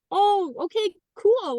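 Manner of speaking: high, impressed mom; a high, delighted little oh okay cool; pleasantly surprised. Retold or imitated. Surprised reply to daughter.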